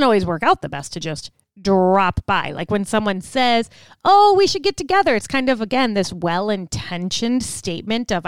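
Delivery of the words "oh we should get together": high, louder breezy promise; cheery polite offer. Retold or imitated. Someone tossing off a polite invitation, cheery. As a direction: sing out a nice offer you won't keep.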